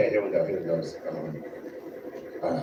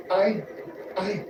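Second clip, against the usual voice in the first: much higher, stammering and shy; a flustered aw-shucks thank you, raised high; bashful Superman; 8 semitones higher, 3 dB quieter, much slower than usual. Acted. Hammed-up Superman thanking Neil, bashful and much higher. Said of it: "And then Superman goes".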